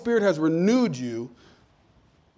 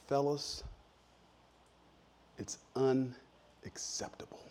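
slow, hushed stern boss disappointment; Pastor McInerney impression; 4 semitones lower, 13 dB quieter, slower than usual. Pastor to his staff, a slow whispered "unacceptable". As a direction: imitate the pastor peering over his glasses, slow and whispered. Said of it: "he used to do it like this".

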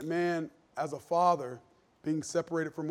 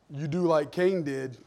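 ordinary delivery

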